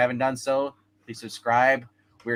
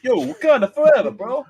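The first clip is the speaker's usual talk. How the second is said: bro Wakanda shout; 9 semitones higher, 6 dB louder than usual. Pretend.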